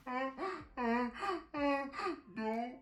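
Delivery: gasping, hammed-up sobs between words; mock bawling. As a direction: act out blubbering, barely getting words out. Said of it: "I just start bawling".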